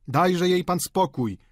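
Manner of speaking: protesting cry, drawn out; calling out. A narrator's reading. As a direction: call it out in mild protest. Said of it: "zawołali obecni".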